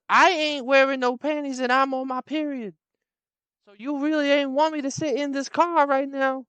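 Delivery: high, loud brash woman repeating. Retold or imitated. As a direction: say it again, even bolder.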